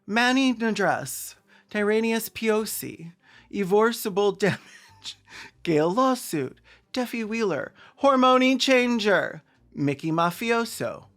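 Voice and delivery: high, drawn-out proclaiming of names; mock roll-call announcement. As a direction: announce each name grandly, with a pause after. Reads out list of joke names.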